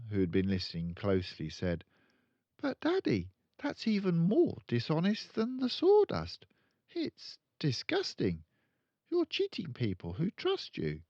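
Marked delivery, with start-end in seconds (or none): 2.6-11.0 put-on high, earnest child; a small girl protesting; little girl voice. Matilda scolding her father, high and earnest.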